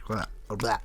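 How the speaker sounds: talking with a retainer in; words slurred by a retainer; wearing a retainer. Real, not acted. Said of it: "I just realized I still have my retainer in".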